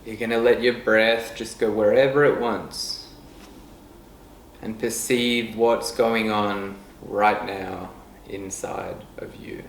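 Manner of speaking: calm meditation guide with long, unhurried pauses; guided meditation. Real, not acted. Leading listeners to notice breath and body, guided meditation voice. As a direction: ease them softly into stillness, letting phrases trail down. We're gonna go into a bit of a meditation right now.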